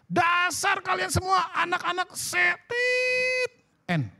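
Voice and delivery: slow, high, shouted scolding; a father yelling at his rowdy sons; angry father's shout. Retold or imitated. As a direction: bellow it like a furious dad. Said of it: "Lalu dia marah".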